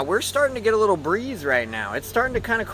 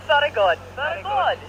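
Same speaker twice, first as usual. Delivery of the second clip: higher, laughing mock Irish accent on very good; laughing fake Irish; 8 semitones higher than usual. Friends laughing at his bad Irish accent, higher and hammed-up. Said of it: "worst Irish accent I've ever heard".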